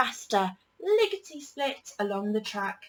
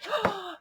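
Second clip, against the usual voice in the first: sharp gasp; 11 semitones higher than usual. A narrator's reading. Sudden bang on the train.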